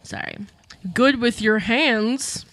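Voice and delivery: usual speaking voice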